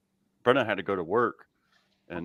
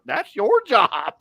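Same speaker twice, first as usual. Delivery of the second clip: high, louder, slow retort; mock exasperation; 12 semitones higher, 6 dB louder, slower than usual. Patient retorting to doctor, mock exasperation. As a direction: drag out an exasperated comeback, pitched up.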